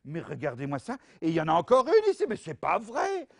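normal talking voice

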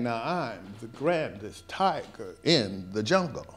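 a slow tiger's brag; boastful tiger. Acted. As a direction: strut like the proudest tiger. Story tiger boasting, read aloud.

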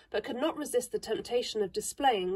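ordinary delivery, nothing marked

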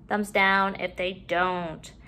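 usual speaking voice

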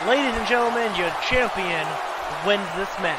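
excited live play-by-play calls; play-by-play commentary. Acted. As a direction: call the match like a ringside commentator.

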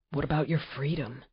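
soft, hushed question; whispered. Acted. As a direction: ask it quietly in her ear. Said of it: "he whispered".

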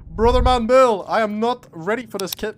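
loud, high excited yell; loud high hype; hyped shout. Real, not acted. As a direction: shout with disbelief at the loot. Hyped reaction to kit.

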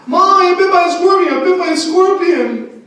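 high, loud panicked yelling; mock panic. Sister yelling to mom about scorpion bite, mock panic. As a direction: shriek it like you're freaking out. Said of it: "She came in freaking out".

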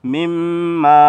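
মিম্মা held long and slow, nasal hum on the doubled মিম; slow chanted মিম্মা; ghunna on mim. Shown as a demonstration. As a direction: stretch the মিম in মিম্মা with a slow falling close. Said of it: "অনুসারে উচ্চারণ".

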